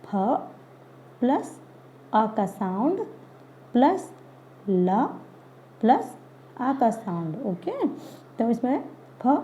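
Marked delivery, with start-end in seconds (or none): 0.1-7.3 फ, आ, ल spoken as separate sounds with pauses, plus rising between them; sounding out letters. Shown as a demonstration. Teacher to children, sounding out फल sound by sound. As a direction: spell फल aloud one sound at a time. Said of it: "तो हम क्या बोलेंगे".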